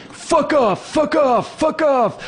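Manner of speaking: high shrill repeated swear; very high mock snarl; angry bully kid. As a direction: spit it like a furious kid. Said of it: "bully snaps during tug of war".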